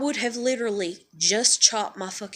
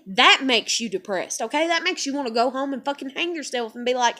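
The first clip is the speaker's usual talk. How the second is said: ordinary delivery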